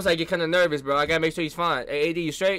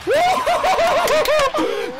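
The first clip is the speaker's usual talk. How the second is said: long high-pitched whoop; excited whoop; 3 dB louder than usual. Real, not acted. Fan celebrating D'Lo's three, long high whoop. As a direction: let out a piercing celebratory cry.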